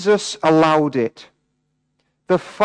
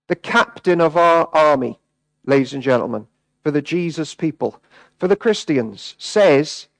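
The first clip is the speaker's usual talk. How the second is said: ordinary talk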